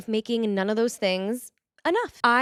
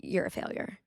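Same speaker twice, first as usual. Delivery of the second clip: breathless whisper of a painful thought; whispered confession; 9 dB quieter than usual. Retold or imitated. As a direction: barely voice the words, ashamed. Confessing her intrusive thought to therapist.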